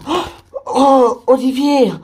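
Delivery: high, loud, drawn-out cry of relief at seeing him; overjoyed shout of a friend's name; excited reunion. Acted. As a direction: call his name, slow and thrilled, like he's back from the dead. Friends find missing Olivier again.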